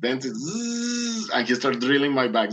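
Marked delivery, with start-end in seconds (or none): 0.5-1.3 high buzzing drill noise. Retold or imitated. Imitating the doctor's drill on his back, high buzzing zzz. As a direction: buzz like a dentist's drill.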